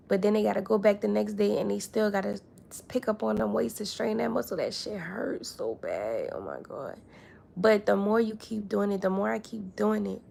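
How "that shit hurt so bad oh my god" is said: pained groan. Acted. Describing sore muscles at gym.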